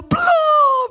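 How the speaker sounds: high, loud, crying answer; frightened mother. Retold or imitated. Scared mother answering the nurse, high crying wail. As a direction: wail it like a scared old woman.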